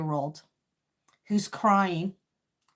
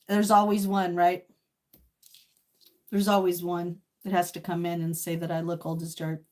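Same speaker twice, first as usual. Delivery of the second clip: ordinary delivery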